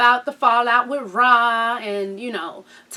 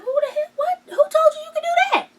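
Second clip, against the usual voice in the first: shocked outraged dad; faster than usual. Retold or imitated. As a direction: play the dad who can't believe what he sees. Dad shocked at belly piercing.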